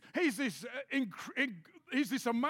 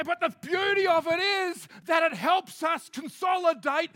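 loud, high preaching burst; preaching shout; 4 semitones higher, 8 dB louder than usual. Acted. Preacher to congregation, a loud emphatic cry. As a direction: belt out the point to the congregation.

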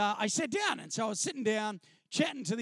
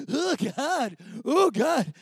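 louder, choked-up oh God; moved and embarrassed; a retold half-sob; overcome oh God; 3 semitones higher, 7 dB louder, much slower than usual. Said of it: "I'm going".

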